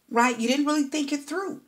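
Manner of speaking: usual speaking voice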